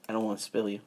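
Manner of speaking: soft unvoiced whisper. Real, not acted. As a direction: whisper it to your cup.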